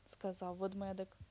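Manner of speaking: usual speaking voice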